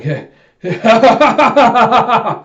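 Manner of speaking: high, loud gloating heh heh; villain laugh. Retold or imitated. Villain gloating over captured Alita, loud high laugh. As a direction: cackle over the trapped girl. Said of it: "and he starts laughing".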